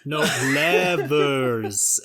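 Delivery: slow, drawn-out levers. Acted. Repeating levers slowly for the confused Beth. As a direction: stretch every sound of levers. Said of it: "And slower".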